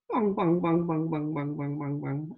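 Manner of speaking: rapid bongs sinking in pitch; imitated bouncing drop. Trading teacher mimicking a plunging trade, comic falling bongs. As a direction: fire off low, falling bongs.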